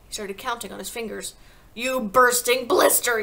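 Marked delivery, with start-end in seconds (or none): 1.7-3.2 loud, drawn-out fury; bellowing tyrant; yelled insult. A narrator's reading. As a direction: fling it out slowly and forcefully. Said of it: "yelled the Trunchbull".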